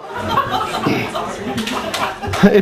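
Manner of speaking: a short, high, airy burst of laughter; quick unvoiced chuckle; breathy laugh. Real, not acted. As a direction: laugh it off at your own joke. Laughing at his own joke.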